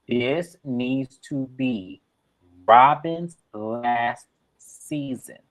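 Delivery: slow, word-by-word ultimatum; deliberate, weighty pronouncement; emphatic demand. Real, not acted. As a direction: spell it out slowly so headquarters gets it. Formal demand addressed to Bravo.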